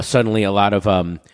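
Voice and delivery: ordinary talk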